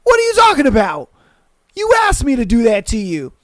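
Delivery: high, loud, exasperated shouting; telling off the girl who asked to be spat on, loud and indignant; retold yell. Retold shouting at hookup partner.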